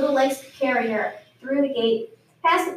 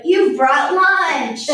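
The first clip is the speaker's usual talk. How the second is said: slow and louder, gleeful; delighted goat; 3 semitones higher, 6 dB louder, much slower than usual. A narrator's reading. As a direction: relish every word of it.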